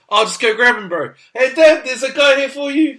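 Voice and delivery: put-on high, loud Kiwi kid calling out; broad Kiwi kid. Kid at the door calls his dad, broad Kiwi. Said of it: "real hoary Kiwi".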